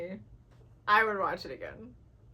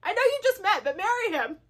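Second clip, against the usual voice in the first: high, loud fangirl squeal; gushing excitement; 11 semitones higher, 9 dB louder than usual. Retold or imitated. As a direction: squeal at the book like a superfan. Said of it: "I was like".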